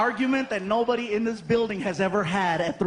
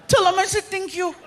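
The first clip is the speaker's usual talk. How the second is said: put-on much higher polite kid; Frankie voice; 10 semitones higher, 6 dB louder than usual. Frankie thanking the crowd, much higher. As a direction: say it sweetly as the kid. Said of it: "Frankie answering".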